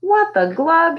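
lightly voiced puzzled mutter; a hint of a girl's baffled mutter. A narrator's reading. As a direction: grumble it to herself. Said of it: "she muttered".

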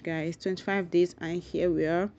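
ordinary talk, nothing marked

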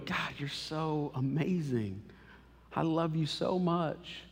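soft and slow, close to God; tender prayer. Pretend. Believer alone with God, tender and soft. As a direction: speak it gently like a private prayer. Acted prayer, intimate whisper-like.

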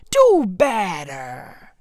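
low, drawn-out reprimand; stern demand. Real, not acted. Comedian scolding social media users, stern and slow. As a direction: draw both words out slowly and low.

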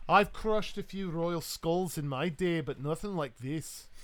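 put-on slow, boastful Scottish accent; Highland Scot. Big human praising the captain's exploits, boastful Highland Scot. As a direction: brag like an old Highland warrior. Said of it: "this world's equivalent of a Highland Scot".

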